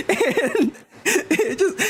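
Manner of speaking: laughing hard, words squeezed out slowly; can't get through it for laughing; breaking up laughing; real laughter. Real, not acted. Laughing at the clogged sink.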